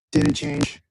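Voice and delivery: crackly, distorted call audio; bad connection. Real, not acted. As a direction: voice crackling through a bad line. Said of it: "It's still crackly".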